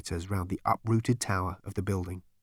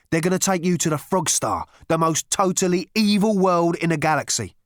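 loud, high, emphatic warning; urgent warning character; 9 semitones higher, 7 dB louder than usual. Acted. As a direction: hammer home the danger at full voice. Voices Rooster's warning to Zaphod.

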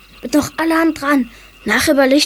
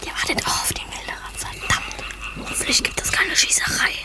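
softer, anxious whisper; worried whisper; 6 semitones lower, 7 dB quieter than usual. Acted. Boy hiding from the gamekeeper, worried whisper. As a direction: fret under your breath.

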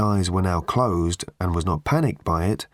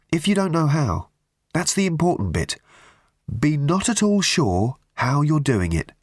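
higher-pitched, encouraging Arthur; lightly voiced urging, pitched up to coax her; gentle urging; 6 semitones higher than usual. A narrator's reading. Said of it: "urged Arthur".

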